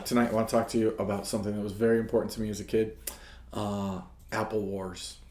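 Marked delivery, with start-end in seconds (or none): 3.5-5.2 slow, low, drawn-out title drop; lower, slow announcement of Apple Wars; dramatic pause. Shown as a demonstration. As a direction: let it hang like a big reveal. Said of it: "Dramatic pause for effect".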